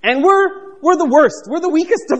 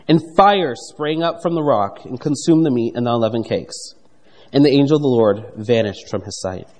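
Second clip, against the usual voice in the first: lower, measured Bible reading voice; scripture reading; 8 semitones lower than usual. Acted. Preacher reads Gideon's exchange from Judges, measured scripture reading. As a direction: read the verses aloud to the congregation.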